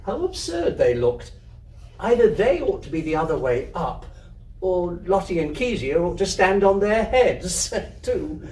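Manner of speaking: ordinary delivery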